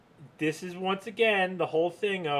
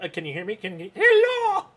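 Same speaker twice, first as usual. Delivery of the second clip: imitating the confused caller; 6 semitones higher, 7 dB louder than usual. Mocking the confused telemarketer on the line, high laughing hello. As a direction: play the baffled telemarketer, breaking up. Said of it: "and they're like".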